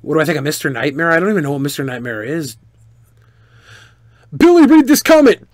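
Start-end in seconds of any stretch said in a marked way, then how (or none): 4.4-5.5 high, loud reading of a viewer's demand; mock chat shout. Streamer reading a viewer's comment aloud, mock loud shout.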